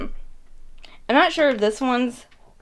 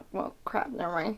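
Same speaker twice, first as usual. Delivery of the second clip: muttered aside; 11 dB quieter than usual. Real, not acted. Quiet annoyed aside.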